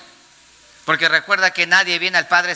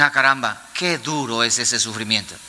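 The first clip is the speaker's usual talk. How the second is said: mock pity; 9 semitones lower than usual. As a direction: mimic shallow churchgoers feeling sorry.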